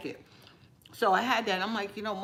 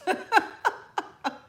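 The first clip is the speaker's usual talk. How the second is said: high laugh; 13 semitones higher, 5 dB louder than usual. Real, not acted. Laugh at own joke.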